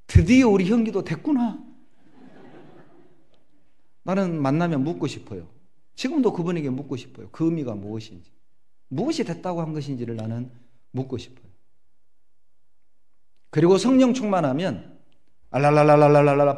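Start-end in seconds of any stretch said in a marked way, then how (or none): 0.1-1.6 high, loud, delighted exclamation; pleased Sunday school teacher. Retold or imitated. Sunday school teacher praising the boy, delighted and loud. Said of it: "반사 선생님이요". 15.5-16.6 loud rapid lalala babble; mock speaking in tongues. Preacher mimicking tongues-speaking believers, loud babble. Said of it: "방언하는 거".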